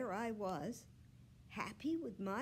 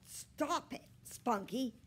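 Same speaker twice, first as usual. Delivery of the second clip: put-on raised, scolding boy's voice; loud, higher-pitched telling-off; shouting; 5 semitones higher, 7 dB louder, slower than usual. A narrator's reading. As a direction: shout at a misbehaving dog. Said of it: "he shouted".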